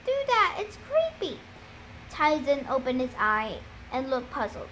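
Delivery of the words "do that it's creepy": put-on much higher, unsettled girl's voice; high-pitched, spooked protest; creeped out. A narrator's reading. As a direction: plead in a high, shivery character voice. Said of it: "Annabeth shivered".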